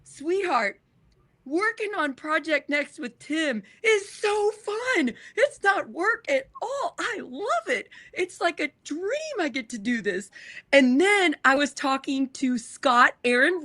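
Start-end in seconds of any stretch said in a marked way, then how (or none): 0.0-10.3 tender reassurance. Retold or imitated. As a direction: soothe him sweetly, a little teary. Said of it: "I had a light bulb where I'm like".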